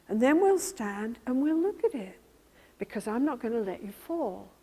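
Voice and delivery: gentle reassurance. Acted. Therapist guiding a frightened client, soft and reassuring. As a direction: lead them gently, step by step. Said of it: "And so you say".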